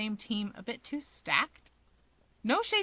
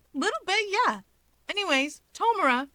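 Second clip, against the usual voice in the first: put-on much higher, light and breezy young man; cheerful Natsuo; 9 semitones higher, 4 dB louder than usual. Natsuo to Dabi, breezy and upbeat. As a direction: brush it off and bounce on happily.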